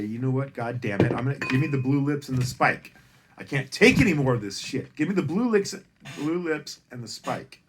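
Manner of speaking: despairing outburst. Retold or imitated. Comic voicing a broken poor person, loud desperate cry. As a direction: cry out, fed up with life.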